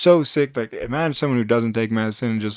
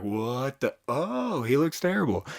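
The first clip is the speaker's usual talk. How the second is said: shocked nurse; 3 semitones higher, 4 dB quieter than usual. Retold or imitated. Nurses react to his face.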